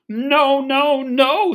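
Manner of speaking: high, loud little wolves. Acted. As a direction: squeak a frightened refusal. Wolves refuse the pig.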